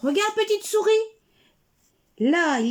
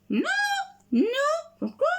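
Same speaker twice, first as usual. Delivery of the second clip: petite voix; 11 semitones higher, 3 dB quieter than usual. Acted.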